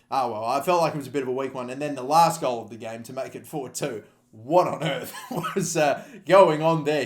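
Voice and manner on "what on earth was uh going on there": laughing in disbelief. Real, not acted. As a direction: laugh through the words. Laughing at the keeper's blunder.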